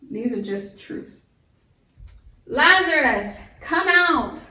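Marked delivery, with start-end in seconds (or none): 2.4-4.4 calling out. Acted. Jesus calling at the tomb.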